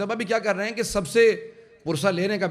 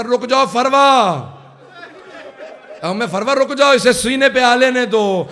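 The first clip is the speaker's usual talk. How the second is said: urgent call; 7 semitones higher, 10 dB louder, slower than usual. Retold or imitated. Hasan calls to wife Farwa.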